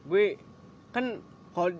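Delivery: usual speaking voice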